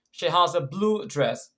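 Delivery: blue picked out as the prominent word; stress on blue. Shown as a demonstration. Teacher repeating an example for learners, stress on blue. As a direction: lean on blue, making it stand out from the other words. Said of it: "The answer is blue".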